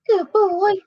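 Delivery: high, bright-eyed praise for the dog; pet praise voice. Real, not acted.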